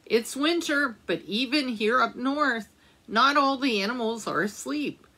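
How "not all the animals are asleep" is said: usual speaking voice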